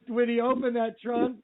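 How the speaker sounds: ordinary delivery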